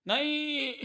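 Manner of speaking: very high, drawn-out acted wail; horrified cry. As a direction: cry out in dread of death. Doomed bandit cries out. (डर से ऊँची, खिंची चीख)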